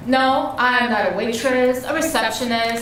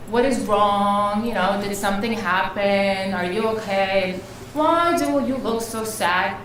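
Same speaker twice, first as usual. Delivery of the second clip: gentle concern; 3 semitones higher than usual. Acted. Quoting women asking after her.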